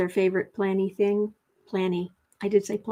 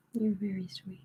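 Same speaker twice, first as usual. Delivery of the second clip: softer, voiceless pet praise; whisper to the cat; 10 dB quieter than usual. Real, not acted. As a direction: hush it tenderly to the cat.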